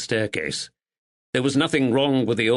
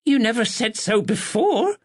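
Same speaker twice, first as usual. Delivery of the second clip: exclaimed; 12 semitones higher than usual. A narrator's reading. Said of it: "her daughter exclaimed".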